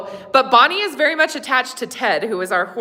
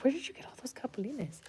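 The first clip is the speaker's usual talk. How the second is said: whispering; 2 semitones higher, 19 dB quieter than usual. Real, not acted. Asking a child.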